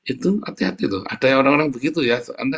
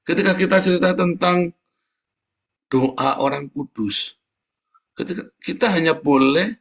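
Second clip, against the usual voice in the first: fervent preaching; 4 semitones higher, slower than usual. Acted. Preacher exhorting the congregation, slow and fervent. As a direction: drive the scripture home from the pulpit.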